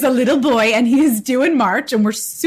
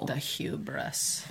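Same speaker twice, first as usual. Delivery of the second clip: dramatic whisper; 8 semitones lower, 16 dB quieter, much slower than usual. Acted. Dry aside.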